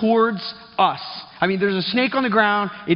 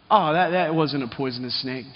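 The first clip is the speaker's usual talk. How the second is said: put-on low, laughing, offhand old-man voice; casual chuckling old grandpa; grandpa shrugging it off; 5 semitones lower than usual. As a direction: brush it off with a chuckle. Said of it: "And he was like".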